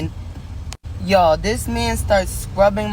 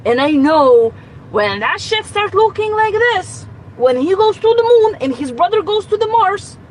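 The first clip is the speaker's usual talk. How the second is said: she gets more worked up with every word; irritated rant; 9 semitones higher, 3 dB louder than usual. Real, not acted. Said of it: "because I'm so irritated".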